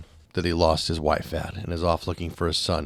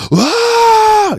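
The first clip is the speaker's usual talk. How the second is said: sudden piercing yell, very high and loud; mock yell; 14 dB louder than usual. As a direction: imitate a man bellowing for no reason. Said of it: "at the top of his lungs".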